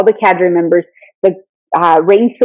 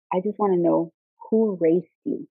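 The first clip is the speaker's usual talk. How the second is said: quiet intimidating; 11 dB quieter than usual. Retold or imitated. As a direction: lean in and murmur it coldly. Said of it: "almost try to whisper, like, in their ear".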